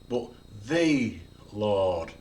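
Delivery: "the" drawn out slowly as thee; stress on THE. Shown as a demonstration. Preacher contrasting any Lord with the Lord, stress on "the". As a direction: lean long on "the" before "Lord". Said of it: "You could emphasize every word".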